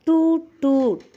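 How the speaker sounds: high, loud toot toot of a car horn. Acted. Teacher voicing the car horn for a child. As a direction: honk like a toy car. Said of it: "car എന്താ പറഞ്ഞത്?".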